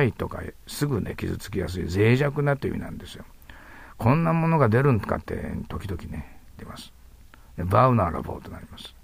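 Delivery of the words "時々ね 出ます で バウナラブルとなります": ordinary delivery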